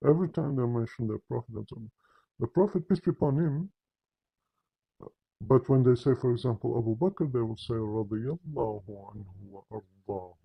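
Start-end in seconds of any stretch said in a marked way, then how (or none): 2.4-3.7 higher, run-together blessing; careless formula. Retold or imitated. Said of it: "pay attention how they say it". 7.8-10.4 lower, drawn-out Arabic honorific; slow and careful. Retold or imitated. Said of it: "They'll say it very slowly, very carefully, very professionally".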